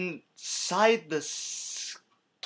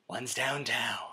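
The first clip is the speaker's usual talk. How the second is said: dramatic whisper; 4 semitones lower, 10 dB quieter than usual. Pretend. Dramatic aside.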